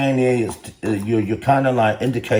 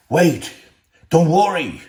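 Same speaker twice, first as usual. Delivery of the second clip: higher, louder mock reassurance; 8 semitones higher, 6 dB louder than usual. Real, not acted. Vlogger mocking food companies with a sarcastic reassuring voice. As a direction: play the false comforter, sarcastically.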